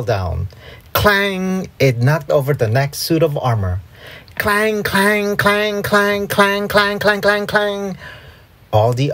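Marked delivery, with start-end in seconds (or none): 0.9-1.7 high ringing metal clang; imitated clang. A narrator's reading. Imitates falling armor. 4.4-8.0 high monotone chain of clangs; imitated clanging. A narrator's reading. Armor falling in a row.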